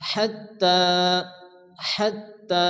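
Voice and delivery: "হাত্তা" with stretched "তা"; teacher's measured "হাত্তা"; elongated vowel. Shown as a demonstration. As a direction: breathe the "হ", hold the end of "হাত্তা". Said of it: "এক আলিফ পরিমাণ টান দিয়ে".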